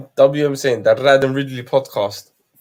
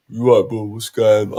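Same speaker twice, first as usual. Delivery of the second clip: lower-pitched imitated British accent; Kush's mad accent; 5 semitones lower than usual. Showing off a friend's mad accent, imitated British lad. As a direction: lightly put on a mate's British accent, pitched down. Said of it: "you know Kush is mad accent".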